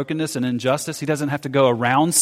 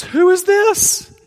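very high, loud, drawn-out squeal; hammed-up shriek of amazement; astonished disciples; 7 dB louder, much slower than usual. Disciples after the storm is calmed, mock astonished squeal. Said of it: "the disciples' response then was".

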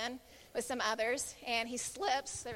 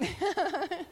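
short higher-pitched chuckle; laugh; 6 semitones higher, 3 dB louder than usual. Real, not acted. Speaker admitting she freaked out, amused laugh. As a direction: laugh at your own story.

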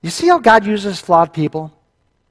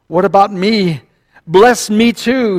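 begging, building higher and louder; Esau; 4 semitones higher, 4 dB louder, slower than usual. A narrator's reading. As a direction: plead to be included, drawing out the last words. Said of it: "he begged".

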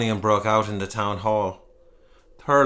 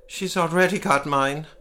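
tearful; 7 semitones higher than usual. A narrator's reading. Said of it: "rubbing his teary eyes".

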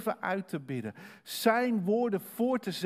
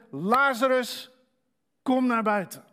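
loud call; 7 semitones higher, 9 dB louder than usual. Acted. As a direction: call him out of the grave. Jezus calls Lazarus from tomb.

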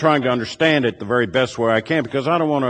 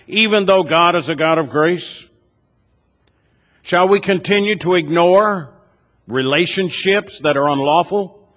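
preaching at full force; 4 semitones higher than usual. Acted. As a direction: drive each question harder toward the congregation. Building preaching refrain, rising force.

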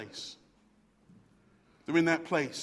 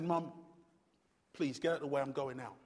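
low, quieter dismissive son; son brushing off; 5 semitones lower, 12 dB quieter, faster than usual. Retold or imitated. Son telling his mother to move aside, low and dismissive. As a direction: shrug her off in a low voice. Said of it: "And the son said".

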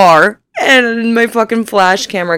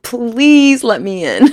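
slow begging through laughter; laughing plea; 4 semitones higher, much slower than usual. Retold or imitated. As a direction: giggle out a helpless please. Pleading with bouncer, laughing.

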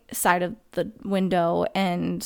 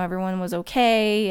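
ordinary talk, nothing marked

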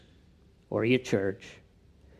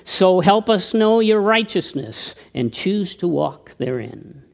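recited poem, louder and lifted; reading a poem aloud; poem recitation; 4 semitones higher, 3 dB louder than usual. Acted. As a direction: declaim the verse. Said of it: "Dennis Fisher wrote a poem".

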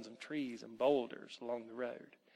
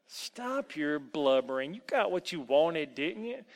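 put-on nasty old elf, taunting; 4 semitones higher, 4 dB louder than usual. Elf jeers at the crying boy, taunting. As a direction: sneer at him, swooping up in pitch.

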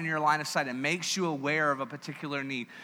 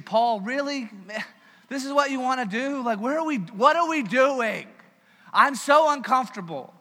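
higher and louder, exasperated pleading with Paul; frustrated Timothy; 5 semitones higher, 4 dB louder than usual. Retold or imitated.

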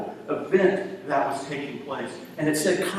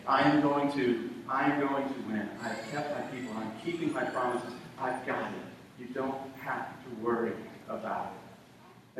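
softer reassuring voice of God; 8 dB quieter than usual. Acted. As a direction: reassure him gently. God reassuring Elijah softly.

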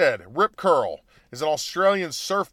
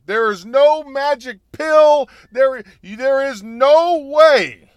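slow, loud, every word hammered; emphatic slow declaration; 7 semitones higher, 8 dB louder, slower than usual. Real, not acted. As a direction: pound each word out slowly. Emphatic rant about golfer.

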